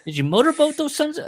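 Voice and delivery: high, loud Vince Vaughn bit; high-pitched Vince Vaughn quote; Vince Vaughn impression. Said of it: "I can't do the Vince Vaughn".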